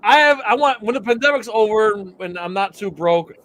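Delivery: normal talking voice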